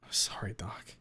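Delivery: soft unvoiced sorry; whispered apology. Anna whispering an apology to her therapist. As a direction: murmur it under your breath. Refusing to share letter.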